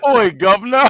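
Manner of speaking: hammed-up London street accent; joking cockney greeting. Mocking a British voice.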